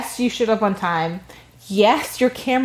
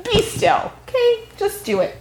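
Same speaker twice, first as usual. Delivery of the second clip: high, exasperated plea; playfully fed-up command; mock scolding; 11 semitones higher than usual. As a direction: plead in a high pitch, half joking. Mock scolding of squirming colleague.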